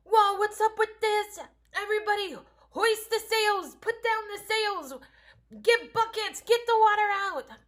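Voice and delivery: high, loud frantic shouting at the crew; panicked disciples. Retold or imitated. Disciples in the storm shouting at each other, panicked. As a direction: yell orders as if the boat is sinking. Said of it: "They were freaking out".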